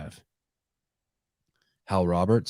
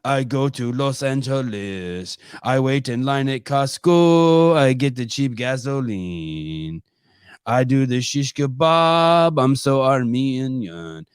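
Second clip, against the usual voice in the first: slow, higher Armenian accent, imitating his dad; dad's Armenian accent; 4 semitones higher, 4 dB louder, slower than usual. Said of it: "talking in my dad's accent".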